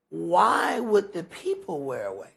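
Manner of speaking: usual speaking voice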